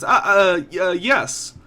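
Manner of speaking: high startled stammer; flustered merchant. Acted. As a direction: caught off guard, stammering. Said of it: "a rather pensive man".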